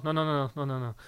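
ordinary talk, nothing marked

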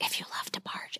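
unvoiced whisper. Real, not acted. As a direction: keep it hushed like gossip. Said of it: "You have to whisper it".

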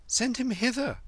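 put-on high, commanding boy; high-pitched boyish order; boy Kim. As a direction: call out in a high boy's voice. Narrator voices Kim calling out.

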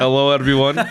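hammed-up Russian-accented politician. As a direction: address the crowd with a Russian accent. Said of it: "I'm doing Russian accent".

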